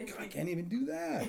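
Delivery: high, laughing complaint; laughing exasperation. Retold or imitated. Husband complaining to his wife, high and laughing. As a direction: moan it out while laughing. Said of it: "I'm like".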